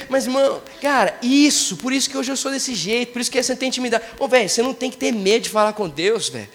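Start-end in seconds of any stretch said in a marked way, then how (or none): none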